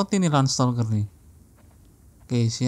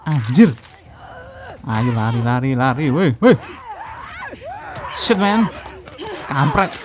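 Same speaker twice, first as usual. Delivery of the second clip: panicking gamer; 2 semitones higher, 3 dB louder than usual. Real, not acted. Fleeing enemies, yelling to run.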